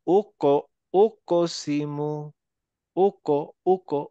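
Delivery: slow "ওকো", ক bouncing into a rounded o; qalqalah echo. Shown as a demonstration. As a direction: draw out "ওকসিমু" with an echo after ক. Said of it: "উকসিমু না".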